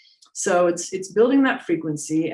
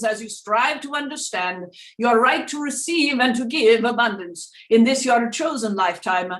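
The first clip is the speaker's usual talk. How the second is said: seance medium; 6 semitones higher than usual. Acted. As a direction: speak as a spirit guide through a medium. Channels Doctor Peebles voice.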